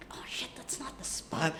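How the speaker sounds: unvoiced whisper to herself. Photographer whispering in panic behind the camera. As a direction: whisper it so nobody hears. Whispered worried thought while shooting.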